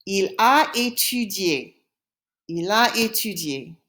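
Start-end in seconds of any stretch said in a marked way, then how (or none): 0.0-1.7 slow, careful French teaching delivery; slow model answer. Shown as a demonstration. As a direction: draw out each word for learners. Teacher gives answer slowly in French.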